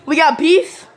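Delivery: loud, high taunting question; loud challenge. Real, not acted. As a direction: call him out loudly.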